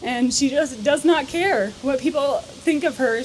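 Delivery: usual speaking voice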